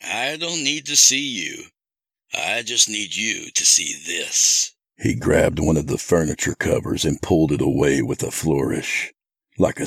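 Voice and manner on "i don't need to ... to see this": high-voiced, controlled villain; coolly steer their attention as the captor; taunting captor. Captor about to unveil his hostage, taunting. Kidnapper's voice in narrated story.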